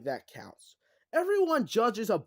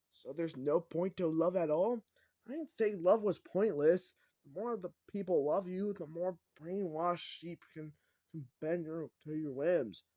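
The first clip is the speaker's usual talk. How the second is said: usual speaking voice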